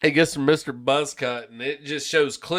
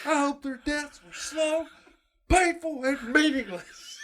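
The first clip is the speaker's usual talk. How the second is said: high, slow, quieter gloating curse; repeated mock teacher voice; 12 semitones higher, 5 dB quieter than usual. Quoting the cartoon teacher again to a friend, high and slow. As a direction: savour the curse a second time, high and slow.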